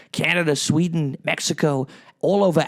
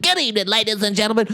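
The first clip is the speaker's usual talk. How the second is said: quick, higher showman's greeting; Cat Williams intro; 6 semitones higher, faster than usual. Retold or imitated. Courtroom defence handed to Cat Williams, quick higher showman intro. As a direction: fire off the announcer's opening fast. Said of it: "defending myself with".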